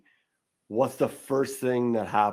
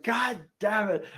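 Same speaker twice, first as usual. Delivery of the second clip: mock frustration; 9 semitones higher than usual. Giant woman cursing as she kicks something, mock frustrated. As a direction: act out blind frustration on a higher pitch. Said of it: "so kind of blind with frustration".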